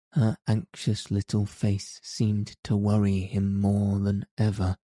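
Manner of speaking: usual speaking voice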